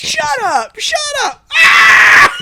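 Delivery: high loud yell of shut up; mock yelling. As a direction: scream it like a panicked kid. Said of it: "so we were yelling".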